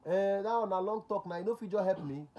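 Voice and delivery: high, loud protesting caller; pleading caller. Retold or imitated. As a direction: act out a caller begging loudly for help.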